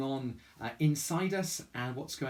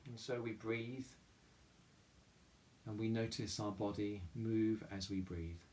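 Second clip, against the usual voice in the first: soft, calm meditation voice with long pauses; guided meditation; 3 semitones lower, 5 dB quieter than usual. Real, not acted. Chapel leader guiding listeners through mindful breathing, guided meditation. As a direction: guide them gently inward, leaving room to breathe.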